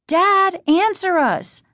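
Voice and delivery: high, loud demand on "Dad". Acted. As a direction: call out and insist on an answer. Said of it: "Margaret demanded".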